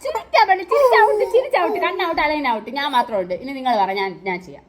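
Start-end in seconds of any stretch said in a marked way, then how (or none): 0.0-2.7 loud, high-pitched referee calls; excited, loud game-leader rulings; calling players out. Real, not acted. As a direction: shout out the fouls, pitched high.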